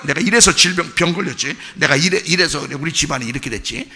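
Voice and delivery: ordinary delivery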